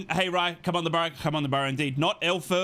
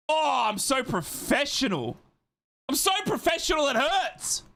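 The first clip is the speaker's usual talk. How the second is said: mock boasting; 10 semitones higher than usual. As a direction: build to a high, hammed-up brag. Mock boasting about the setup.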